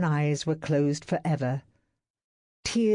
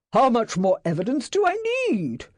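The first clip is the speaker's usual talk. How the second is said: higher, louder yell; 8 semitones higher, 4 dB louder, faster than usual. A narrator's reading. Employer yelling at secretary. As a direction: yell in exasperation. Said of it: "Astley yelled".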